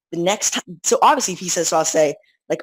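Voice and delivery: ordinary talk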